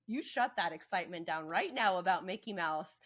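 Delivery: loud, forceful mock telling-off; hammed-up stern parent, loud; mock scolding. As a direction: order a child around with exaggerated force. Voicing an absurd scolding of child.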